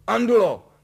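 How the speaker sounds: high, very loud summons; roaring. A narrator's reading. Master summoning the maid, roaring. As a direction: bellow the name. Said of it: "zařval".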